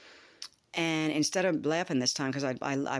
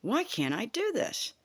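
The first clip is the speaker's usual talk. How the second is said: higher, slow, bewildered; puzzled whine; 8 semitones higher than usual. Retold or imitated. Frustrated at the strap exercise, slow high puzzled whine. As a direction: stretch out the confusion. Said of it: "I'm going".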